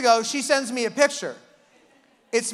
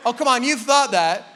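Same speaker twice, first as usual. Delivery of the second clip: ordinary delivery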